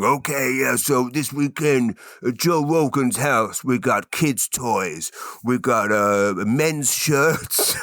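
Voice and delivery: slow, drawn-out silly Joe Rogan impression. Host mocks Joe Rogan listing yard sale items, silly slow voice. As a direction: drag it out like a goofy Rogan. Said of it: "I'll do it in that silly voice".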